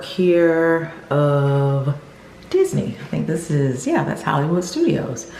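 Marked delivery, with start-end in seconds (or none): none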